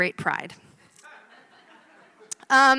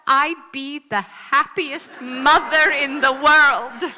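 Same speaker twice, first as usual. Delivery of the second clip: hammed-up martyr; 5 semitones higher, 8 dB louder, slower than usual. Mother's exaggerated guilt line.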